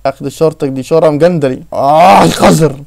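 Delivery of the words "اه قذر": loud, drawn-out groan of disgust; frustrated cry. Real, not acted. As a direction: cry out slowly at a dirty kill. Reacts to dying in game. (صيحة غيظ عالية وممدودة)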